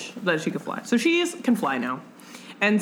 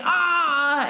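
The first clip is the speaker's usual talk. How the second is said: mock cry; 10 semitones higher, 3 dB louder than usual. Akiva's cry at the Hamsas.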